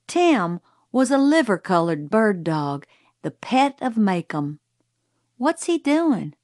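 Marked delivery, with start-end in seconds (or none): 5.4-6.3 put-on higher-pitched child's question; curious child. A narrator's reading. Young Scout asking Jim about the dog, curious. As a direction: ask it like a puzzled kid.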